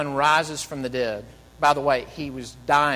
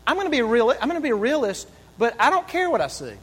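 determined quote; 6 semitones higher, 3 dB louder, faster than usual. Retold or imitated. Preacher voices woman's resolve.

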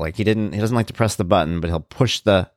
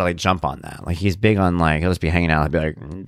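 ordinary talk

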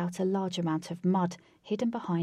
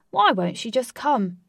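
frustrated; 4 semitones higher, 8 dB louder than usual. Acted. Flash frustrated.

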